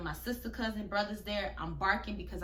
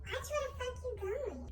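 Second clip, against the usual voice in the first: mocking high, hushed repeat; hushed mocking of the girl's threat; said low; 6 dB quieter than usual. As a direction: imitate her quiet mumble. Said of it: "She still said it low".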